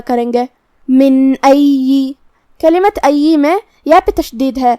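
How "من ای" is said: من with a clear unhummed n, then ای drawn out; clear n, no nasal hold. Shown as a demonstration. Teacher showing clear noon, من crisp and ای held long. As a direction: chant من cleanly, then hold the doubled y of ای. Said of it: "ہم یہاں نون ظاہر کریں گے اور بغیر غنہ".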